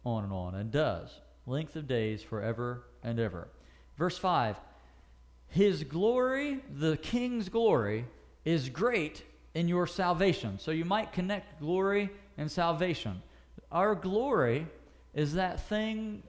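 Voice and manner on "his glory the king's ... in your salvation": slow, deliberate Bible reading; solemn slow reading of the psalm; scripture reading. Acted. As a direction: read the verse slowly and reverently.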